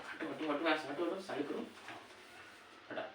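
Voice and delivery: usual speaking voice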